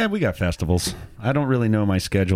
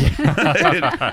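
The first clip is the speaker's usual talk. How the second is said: louder, higher burst of laughter; 7 semitones higher, 6 dB louder than usual. Real, not acted. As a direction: break into a laugh. Laughs keeping up with Timmy.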